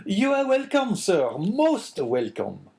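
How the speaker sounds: high, effusive innkeeper voice; astonished. A narrator's reading. Innkeeper welcoming an unexpected guest, astonished. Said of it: "repeated the astonished Caderousse".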